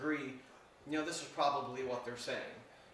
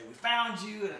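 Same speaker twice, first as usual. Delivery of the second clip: higher, louder mock discovery cry; playful triumphant call; 6 semitones higher, 8 dB louder, much slower than usual. Seeker calling out to the hidden girl, playful triumph. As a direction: pounce on it like a seeker. Retold hide-and-seek find, loud.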